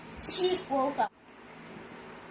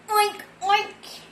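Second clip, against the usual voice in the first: higher piggy oinks; 7 semitones higher than usual. Acted. Pig oinking to the sleeping dog, piggy oinks. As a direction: oink it twice like a pig. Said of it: "and pig went".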